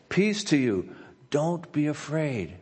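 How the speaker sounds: slowed, tender promise; gentle reassurance. Acted. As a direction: comfort a frightened listener. Pastor reading God's comfort aloud.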